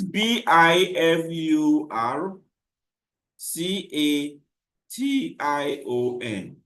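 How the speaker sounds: slow, letter by letter; spelling it out. Shown as a demonstration. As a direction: spell each letter slowly and clearly. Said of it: "I didn't get the word bifurcation".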